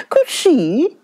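high, scornful echo of Cushy; indignant repeat. A narrator's reading. Wife scornfully repeats the husband's request, high. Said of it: "shrieked the wife".

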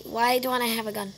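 usual speaking voice

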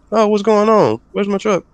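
high, sing-song playing dumb; mock clueless innocence. Popping up all casual after the ambush, mock clueless. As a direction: act like you just woke up and noticed nothing. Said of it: "And I just popped up like".

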